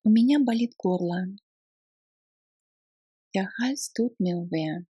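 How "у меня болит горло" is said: ordinary talk, nothing marked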